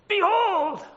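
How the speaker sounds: Behold! called out high, a big demo shout. Shown as a demonstration. Preacher shouts the opening word to wake the congregation. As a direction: cry out Behold! to grab attention. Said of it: "I'd like to shout really loud".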